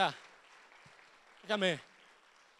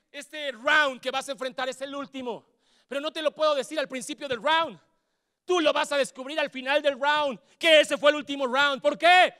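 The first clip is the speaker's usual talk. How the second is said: rising, louder preaching; building sermon; 5 semitones higher, 7 dB louder than usual. Real, not acted. Pastor building up the congregation, louder and louder. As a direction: build toward the final round.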